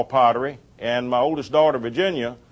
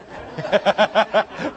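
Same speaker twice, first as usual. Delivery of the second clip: a real laugh, pitched up; hearty laugh; 5 semitones higher than usual. Real, not acted. Preacher laughs at his own joke, a real laugh. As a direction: let a laugh break out.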